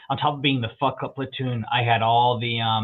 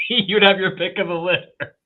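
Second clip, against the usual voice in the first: higher and louder, repeating the line through laughter; laughing; 7 semitones higher, 4 dB louder than usual. Real, not acted. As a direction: crack up as you say it again.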